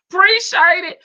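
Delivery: loud country-accented thanks. Shown as a demonstration. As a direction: say it in a big country voice. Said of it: "in my country voice".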